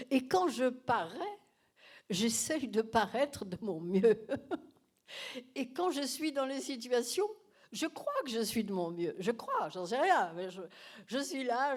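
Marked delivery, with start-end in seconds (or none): none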